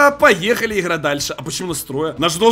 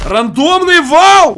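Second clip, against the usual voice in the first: hyped; 7 semitones higher, 7 dB louder, much slower than usual. Real, not acted. Announcing purchase.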